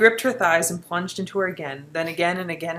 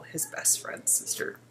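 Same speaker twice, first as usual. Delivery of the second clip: whisper; 11 dB quieter, slower than usual. A narrator's reading. Whispered line in erotic reading.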